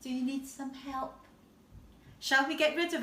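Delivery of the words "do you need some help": ordinary delivery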